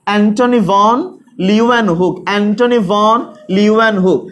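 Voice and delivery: Antony ভন Leeuwenhoek spelled out slowly, each part distinct; slow careful pronunciation. Shown as a demonstration. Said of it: "একবার উচ্চারণ করবো না". (Antony ভন Leeuwenhoek ধীরে, স্পষ্ট করে উচ্চারণ)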